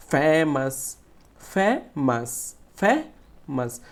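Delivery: "Famous" very slow, leaning on "ফে"; stress on first syllable. Shown as a demonstration. Teacher showing pupils how to stress "famous", first syllable emphasized slowly. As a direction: draw out and press "ফে", then let "মাস" follow. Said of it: "একটু loudly মানে জোরে উচ্চারণ করতে হবে".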